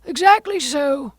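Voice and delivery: high, excitable Mad Hatter; fussy, animated gentleman. A narrator's reading.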